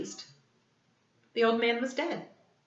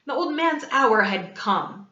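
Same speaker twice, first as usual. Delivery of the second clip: ordinary delivery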